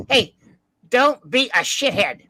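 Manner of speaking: a lightly voiced smug heckler; imitating Chaykin. Mimicking the con heckler, slow and mocking. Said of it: "comes in with his voice".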